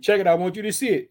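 ordinary talk, nothing marked

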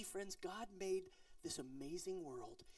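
ordinary talk, nothing marked

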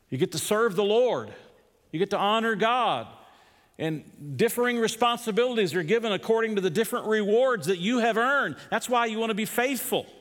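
high, loud, rising sermon climax; fiery preaching. Acted. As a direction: preach it home with full force. Builds to full preaching.

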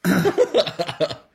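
loud, high, real laugh; loud burst of laughter. Real, not acted. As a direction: crack up out loud. Host laughs at the coincidence.

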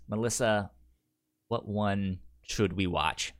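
ordinary talk

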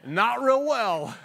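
slow, said through a laugh; real laughter through the words. Real, not acted. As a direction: laugh it out, ruefully amused. Laughs at his own failure.

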